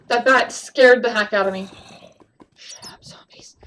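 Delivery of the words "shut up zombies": very soft, slow whisper. Real, not acted. Gamer telling off nearby zombies, slow whisper. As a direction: whisper it slowly under your breath.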